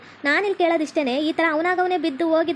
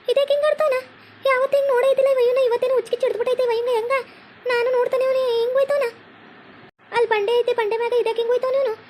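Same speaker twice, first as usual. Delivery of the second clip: high-pitched crying wail over her fallen husband; wailing lament; 7 semitones higher than usual. Acted. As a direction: wail in disbelief, voice high and breaking. Wife wails as husband falls.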